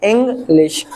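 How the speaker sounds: इंग्लिश in two beats, ing, then lish; इंग्लिश cut into ing and lish; split into syllables. Shown as a demonstration. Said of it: "एक इंग और दूसरा लिश".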